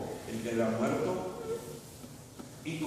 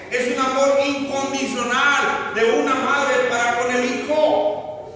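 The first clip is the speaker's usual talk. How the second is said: raised, impassioned sermon voice; fervent preaching; 11 semitones higher, 8 dB louder than usual. Acted. Preacher urging the congregation about a mother's love, fervent preaching.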